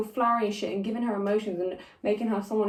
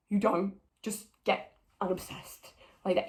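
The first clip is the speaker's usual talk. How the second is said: restrained exasperation; 2 semitones lower, 7 dB quieter than usual. Real, not acted. Ranting at the show about Eve, quietly exasperated. Repeats the protest, held back.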